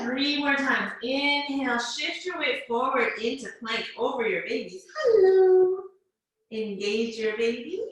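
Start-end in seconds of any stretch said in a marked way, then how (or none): 5.0-5.8 high, loud, sustained hello. Real, not acted. Greeting baby in plank.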